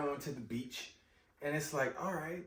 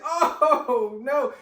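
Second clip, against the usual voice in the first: much louder, higher, stunned; loud stunned fan reaction; shocked exclamation; 8 semitones higher, 11 dB louder than usual. Real, not acted. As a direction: cry it out at the screen.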